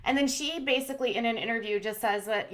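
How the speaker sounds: ordinary delivery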